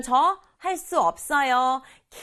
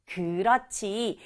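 usual speaking voice

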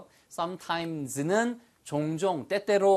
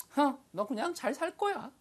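high-pitched, softer mock huff; playfully snubbing, pitched way up; huffy brush-off; 10 semitones higher, 5 dB quieter than usual. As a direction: act a dismissive "hmph" in a put-on high voice. Acted dismissive reply to lovelorn line.

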